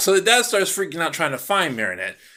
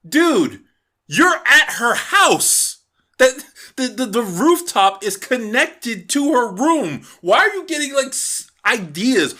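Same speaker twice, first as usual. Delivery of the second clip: higher, louder, incredulous rant at the screen; exasperated yelling; 7 semitones higher, 3 dB louder than usual. Retold or imitated. Reviewer shouting at Cat Noir, exasperated and loud. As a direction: yell it slowly like you can't believe him. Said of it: "and I'm like".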